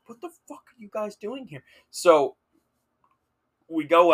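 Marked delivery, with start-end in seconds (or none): 0.1-1.6 high, soft, incredulous half-whisper; hushed disbelief. Retold or imitated. Student facing friend and campus police, hushed shock. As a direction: squeak it out under your breath.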